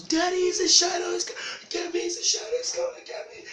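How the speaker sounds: put-on very high, loud squealing kid; hammed-up falsetto panic; panicked child. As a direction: shriek in terror to daddy in a child's voice. Frightened child sees a shadow.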